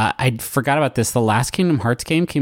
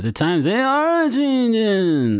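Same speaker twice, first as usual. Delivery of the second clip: slow, high, bad Dylan drawl; [name] impression; 7 semitones higher, much slower than usual. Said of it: "That's a really bad [name]".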